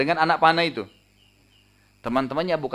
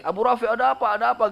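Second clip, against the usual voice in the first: high-pitched urgent calling; retold disguised shout, pitched well up; clamouring crowd voice; 10 semitones higher, 4 dB louder than usual. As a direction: call out like a commotion of people. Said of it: "dengan suara gemuruh seakan-akan ramai orang".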